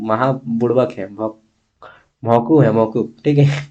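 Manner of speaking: usual speaking voice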